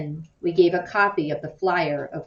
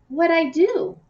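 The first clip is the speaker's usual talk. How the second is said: put-on high, louder wounded boy's cry; hurt boy asking what he did wrong; 11 semitones higher, 4 dB louder than usual. A narrator's reading. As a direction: protest the unfairness, stung. Jason hurt, asked to leave.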